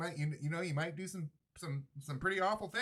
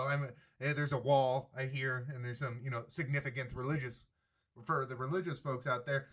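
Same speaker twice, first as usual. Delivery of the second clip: normal talking voice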